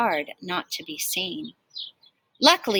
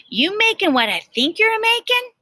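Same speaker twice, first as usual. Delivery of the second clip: high, eager, a bit louder; excited question; 13 semitones higher, 4 dB louder than usual. A narrator's reading. Jim the fireman hopes Annie is cooking poke salad. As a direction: pitch it way up with delight. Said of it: "he inquired".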